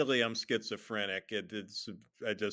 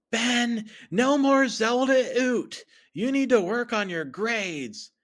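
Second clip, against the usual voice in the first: high, loud, drawn-out nagging mom; high-pitched mother laying down the law; scolding mother; 13 semitones higher, 7 dB louder than usual. Acted. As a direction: scold your kid in a shrill-pitched mom voice. Storyteller voices scolding mother.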